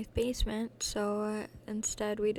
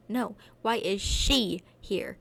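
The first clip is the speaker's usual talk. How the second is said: angry demand; 8 dB louder, slower than usual. Acted.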